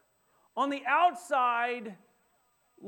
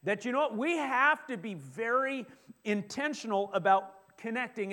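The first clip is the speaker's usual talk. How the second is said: normal talking voice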